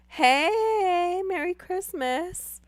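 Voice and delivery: high squeaky greeting. Pretend. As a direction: squeak out a cheery hello.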